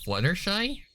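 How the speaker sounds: a small, high, questioning call; timid filly voice. Acted. Sweetie Belle taps the mare, unsure and timid.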